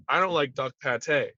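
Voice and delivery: ordinary talk, nothing marked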